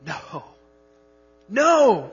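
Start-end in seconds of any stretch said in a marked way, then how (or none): 1.5-2.1 a much higher, louder, forceful no; emphatic no. Shown as a demonstration. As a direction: slam the word No down hard. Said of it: "the most emphatic way to say no".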